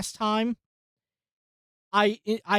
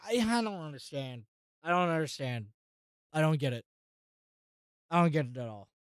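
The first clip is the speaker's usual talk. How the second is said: much softer, lower, muttered to self; baffled and deflated; 4 semitones lower, 7 dB quieter, faster than usual. Real, not acted. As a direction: sink into confusion. Baffled frustration, words fading.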